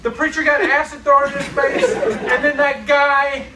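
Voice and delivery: high, loud, laughing through words; half laughing, can't believe it; laughing disbelief. Real, not acted. Laughing disbelief at cursed movie.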